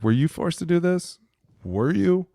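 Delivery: high, pointed questioning; mock incredulous demand; incredulous challenge. Player challenging coaches.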